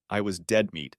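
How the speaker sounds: ordinary delivery, nothing marked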